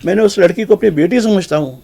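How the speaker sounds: high, loud desperate plea; pleading cry. Retold or imitated. Arrested man cries out to the crowd, high and loud pleading. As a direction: cry out that you did nothing wrong. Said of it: "وہ چیخ رہا تھا".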